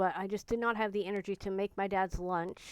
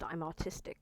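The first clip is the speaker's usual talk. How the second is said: soft unvoiced whisper, almost secret; whispered aside; 3 semitones lower, 7 dB quieter than usual. Real, not acted. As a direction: breathe it out as a quiet confession. Quiet aside before repeating it.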